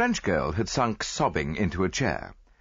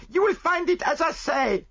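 accusing maid voice; 5 dB louder than usual. A narrator's reading.

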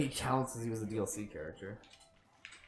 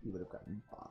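softer muttered echo to himself; quiet repeat; 3 semitones lower, 12 dB quieter than usual. Shown as a demonstration. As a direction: mutter it again under your breath. Repeats line, second take softer.

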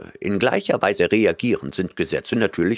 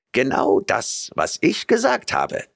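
curt snap, pitch jumping higher at the end; snapping; 7 semitones higher than usual. A narrator's reading. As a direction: bite the words off impatiently. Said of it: "schnauzte Van Manderpootz".

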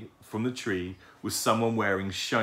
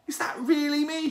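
very high-pitched owlet amazement; disbelieving. A narrator's reading. Owlet seeing his finished portrait, disbelieving. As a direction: squeak it in wonder. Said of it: "could hardly believe his eyes".

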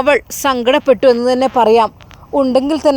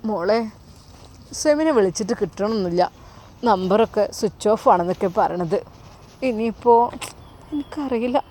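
a mother softly tearful, telling her daughter the son's phone is off; 2 semitones lower, 6 dB quieter than usual. Acted. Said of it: "Aishumma കരയാൻ തുടങ്ങി".

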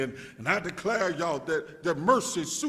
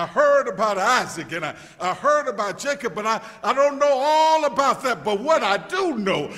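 louder, rising preacher's call toward a shout; full-force preaching; 6 semitones higher, 11 dB louder than usual. Acted. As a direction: build it up like a Sunday climax.

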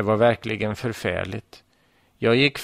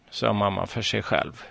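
ordinary delivery

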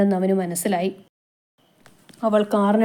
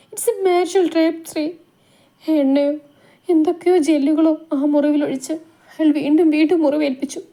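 higher, understated tearful telling; tearful confession; 6 semitones higher than usual. Acted. Wife confessing abuse to Sree, lightly tearful. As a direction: pour out the pain without real sobs. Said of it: "പൊട്ടിക്കരഞ്ഞു പറയുന്നവളുടെ".

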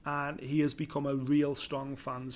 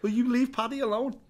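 higher and louder, hamming up loyalty; mock protective; 9 semitones higher, 6 dB louder than usual. Telling co-host how he defended Paddy, playful higher and louder. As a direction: stick up for Paddy in jest. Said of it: "And I went".

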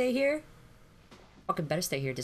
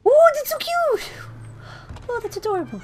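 very high-pitched coo; squealed, high adoring voice; 12 semitones higher, 6 dB louder than usual. Real, not acted. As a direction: coo it high, as at something cute. Cooing at something cute.

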